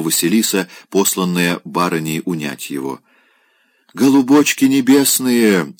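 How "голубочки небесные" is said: высоко и приторно-ласково; сюсюкает, будто умиляясь; сладенький голос. Acted. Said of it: "сладеньким голосом начал Опёнкин". (Опёнкин сладеньким голосом умиляется барышнями)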